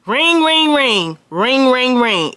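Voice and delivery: sing-song ring, ring, ring, high then dropping; imitated phone ring. Imitating the front desk calling the room, sing-song ringing. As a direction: mimic a phone going off.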